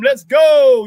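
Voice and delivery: loud, punchy rallying cry; hype call. Real, not acted. Host rallying the sports fans, loud and punchy. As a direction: fire up the listeners.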